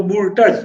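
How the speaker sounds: वोल्टेज, with an extra L like "voltage"; mispronounced Wurtz. Shown as a demonstration. Teacher recalling students' old reading, वोल्टेज mispronounced like "voltage". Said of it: "सही इसका जो उच्चारण है".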